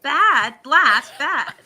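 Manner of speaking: high, loud word-testing. Acted. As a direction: punch each word as a possible lyric. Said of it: "He's like".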